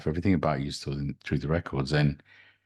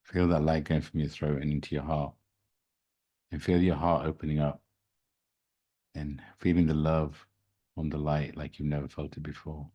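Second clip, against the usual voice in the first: guided meditation. Acted. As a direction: guide them into calm, gently.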